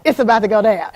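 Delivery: loud, high mock threat to the coach; hammed-up impatient mom; it's about to go down, loud and high; impatient ego. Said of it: "My ego is like".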